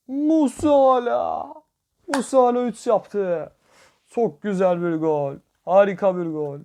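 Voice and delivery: high, loud, drawn-out goal cry; excited goal call. Real, not acted. Gamer cheering his goal to viewers, high and loud. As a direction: belt out the scorer's name like a commentator.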